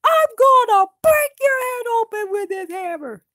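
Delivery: high, loud furious dwarf; angry threat. Acted. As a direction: threaten to smash his head.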